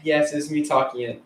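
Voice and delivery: ordinary talk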